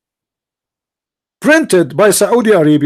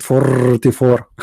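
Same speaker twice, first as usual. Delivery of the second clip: imitated excited conductor; 4 semitones lower, slower than usual. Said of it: "He got so excited".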